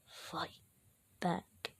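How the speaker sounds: very soft, slow voice inside his head; inner voice. Acted. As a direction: murmur it slowly, with a gap between the words. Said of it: "the voice inside him says".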